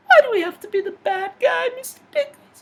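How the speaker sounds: very high, fast whiny henchman voice; shrill fast sidekick complaint; squeaky henchman. Acted. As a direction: whine like a silly cartoon sidekick. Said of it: "the villain".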